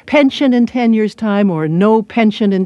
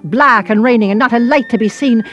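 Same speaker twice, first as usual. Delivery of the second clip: quick, higher Irish voice tumbling along; emphatic Irish rant; 4 semitones higher, faster than usual. Acted. Irish girl explains dismal village winters, emphatic Irish rant. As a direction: rattle off the complaint in an Irish accent.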